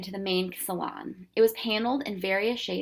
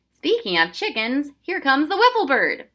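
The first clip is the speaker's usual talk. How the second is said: put-on excited boy voice; 6 semitones higher, 8 dB louder than usual. Boy announces bird, loud.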